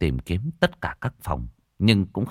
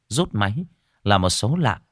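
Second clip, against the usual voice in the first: usual speaking voice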